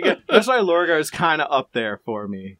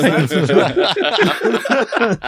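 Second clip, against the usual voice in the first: drawing out "That's a joke" slowly through laughter; slow laughing "That's a joke"; laughing, stretched out; 5 semitones higher, 3 dB louder, much slower than usual. Shown as a demonstration. Repeating the disclaimer, slow and laughing. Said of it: "That's a joke for legal reasons".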